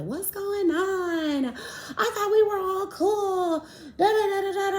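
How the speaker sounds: high, slow whiny mock of the pregnant homegirl; mock whiny friend. Telling her friend the drama, high mock whine.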